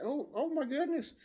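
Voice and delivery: high-pitched astonished gasp of words; retold amazement. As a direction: re-enact being stunned by a find. Retelling reaction to the guitar.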